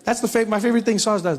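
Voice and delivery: normal talking voice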